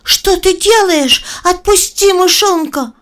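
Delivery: high, loud, slow shout at a snake; shouting girl. Acted. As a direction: yell it out to stop the snake. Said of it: "Маша закричала на змею".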